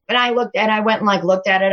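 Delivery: ordinary talk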